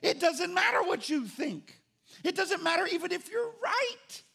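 high, loud stern command; love shouting down anger. Retold or imitated. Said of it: "love comes in and says".

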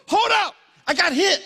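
high, loud cry of hold up; fired-up preacher yell; preaching shout. Real, not acted. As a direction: shout the turn of the story. Preaching climax.

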